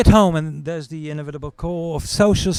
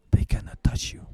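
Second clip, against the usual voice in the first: conspiratorial whisper. Retold or imitated. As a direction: whisper the secret. Lawyer's confidential reveal.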